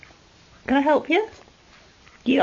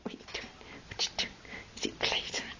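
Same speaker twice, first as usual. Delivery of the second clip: very soft, mostly whispered; hushed pet talk; 6 semitones lower, 15 dB quieter, much faster than usual. Real, not acted. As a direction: keep it barely audible.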